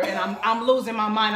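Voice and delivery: ordinary delivery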